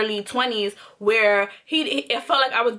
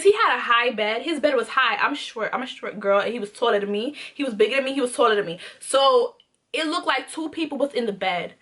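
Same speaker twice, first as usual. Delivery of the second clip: normal talking voice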